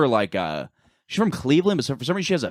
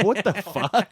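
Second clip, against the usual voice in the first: laughing disbelief; 8 semitones higher, slower than usual. Retold or imitated. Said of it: "I'm like".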